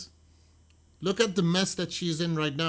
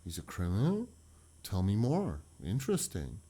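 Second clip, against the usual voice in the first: low, soft and intrigued; a lightly voiced seductive listener; sultry curious woman; 5 semitones lower, 4 dB quieter than usual. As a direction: lean in, hungry for gossip. Katie voiced curious about criminal.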